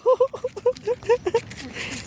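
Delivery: high-pitched giggle; long, high gleeful laugh; delighted laugh. Real, not acted. Delight at toddler walking.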